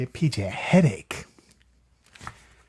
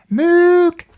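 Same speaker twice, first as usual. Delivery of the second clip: high loud honk; mock moose call; 7 dB louder than usual. PJ tries the moose call again, a high honk. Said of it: "P J is going".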